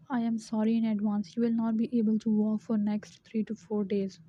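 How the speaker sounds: usual speaking voice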